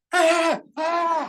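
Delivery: high, loud mock shriek of alarm. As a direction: shriek it out like a woman chasing the cab. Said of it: "running down the street going".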